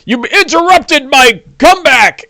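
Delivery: high, outraged orc protest; indignant orc. Acted. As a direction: whine it like an offended brute. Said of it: "he's like".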